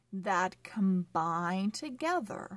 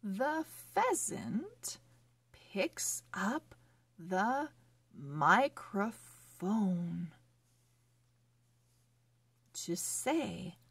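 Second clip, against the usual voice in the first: slow, careful storybook reading; reading to children; slower than usual. Acted. Teacher reading a phonics story to children, slow and clear. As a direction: read each word clearly for young readers.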